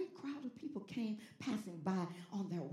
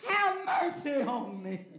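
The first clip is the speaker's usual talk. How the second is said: loud, slow, raised plea; Bartimaeus crying out; 6 semitones higher, 10 dB louder, slower than usual. Acted. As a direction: cry out slowly, begging for mercy. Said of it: "Bartimaeus cried out".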